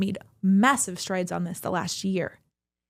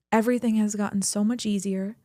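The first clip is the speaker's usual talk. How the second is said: ordinary delivery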